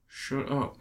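Moses hushing him, barely above a murmur; a quiet, flat shutdown; mild mock hushed shut up. Film fan retelling how Moses hushes his follower, quietly. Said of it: "Moses is like".

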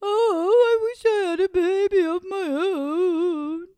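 high, loud wailing lament; bemoaning.

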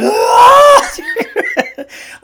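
high, loud burst of laughter; real laughter. Real, not acted. Recalling following the sneeze, real laughter. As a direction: crack up out loud. Cracking up at the memory.

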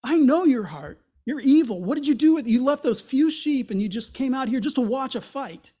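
imitating an older brother's harsh scolding; angry rebuke. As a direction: tear into your little brother. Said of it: "his older brother rebukes him".

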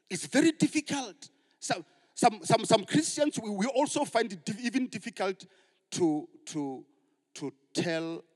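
loud, high preacher's cry; full-force preaching. Acted. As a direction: build to a rousing shout.

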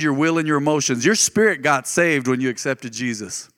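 ordinary delivery

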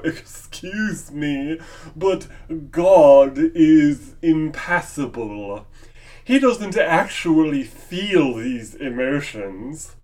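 prim, slow know-it-all correction; snooty theologian. Retold or imitated. High-minded theologian lecturing the preacher, prim and slow. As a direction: correct the preacher like a pedantic scholar. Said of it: "theologically brained individual that's gonna go".

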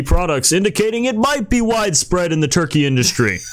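newscaster read with lively emphasis; PSA voice. Acted. Host reads a salmonella report to friends, PSA voice. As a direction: read it out like a public service announcement. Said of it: "let me read it in my, uh, my P S A voice".

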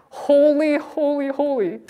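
high, sustained, reverent proclamation; exalted worship cry. Acted. Preacher reading the heavenly creatures' praise to the congregation, high exalted cry. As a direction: lift each word high and hold it.

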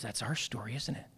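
very soft, low and tender; hushed recognition. Retold or imitated. Old woman recognising her husband and their story, hushed and tender. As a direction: retell it in a hushed, intimate voice.

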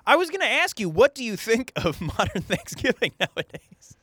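high-pitched question breaking into laughter; laughing hard. Real, not acted. Host asking Lincoln about modern Thanksgiving, laughing hard. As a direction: crack up while asking the question. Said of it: "Why are you laughing so hard?".

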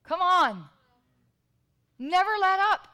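high, loud exhortation; preaching emphasis. Real, not acted. Preacher rousing the congregation, loud and high. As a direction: call the church to never let up.

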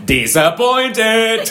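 high, loud, slow drawn-out yell; hammed-up high shout; mock yell. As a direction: bellow it in comic outrage. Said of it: "Otto huutaa".

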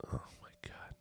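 hushed, breathy whisper of dread. Retold or imitated. Guest hears a fight outside his hotel door, whispering in alarm. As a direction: whisper it like someone might hear. Panicked thought hearing the fight.

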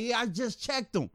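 lightly voiced thief. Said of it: "He's like".

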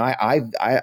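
ordinary talk

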